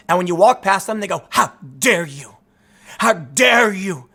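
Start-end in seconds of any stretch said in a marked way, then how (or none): 1.3-2.4 low, slow, lightly voiced seething activist; a hint of a scolding Greta Thunberg; seething angry activist. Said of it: "looking angry". 3.0-4.1 scolding a stranger on the street, hammed-up angry yell; angry activist shout. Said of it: "looking angry".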